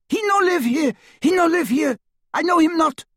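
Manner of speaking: high, loud foreign woman's plea; panicked, very high-pitched denial; frightened cry. A narrator's reading. Terrified woman denying she knows the man, crying out high. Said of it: "she cried".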